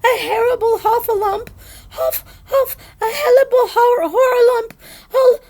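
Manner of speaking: very high, loud panicked wailing; frantic little creature tripping over words; Piglet crying out. A narrator's reading. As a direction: shriek the alarm, tongue tangled with terror. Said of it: "still crying out".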